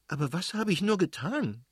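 high-pitched helpless plea; wife's aggrieved appeal; distressed protest. A narrator's reading. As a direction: protest innocence, upset and pleading. Wife protests during acted quarrel.

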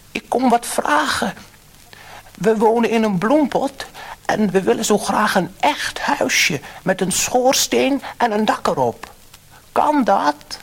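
high, loud hopeful asking; a little man begging the fish for a house; high and loud, eager request; pleading fisherman. A narrator's reading.

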